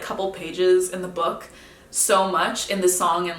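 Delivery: usual speaking voice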